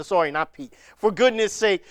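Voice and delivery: ordinary talk, nothing marked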